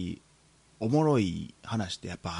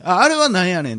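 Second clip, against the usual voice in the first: quoting the customer; 7 semitones higher, 8 dB louder than usual. Retold or imitated. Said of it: "って言われて".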